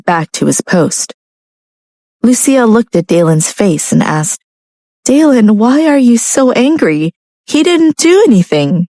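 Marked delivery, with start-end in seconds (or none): 5.0-8.9 higher, protesting wife; protest, hurt and puzzled; upset young woman. A narrator's reading. Narrator voicing Lucia.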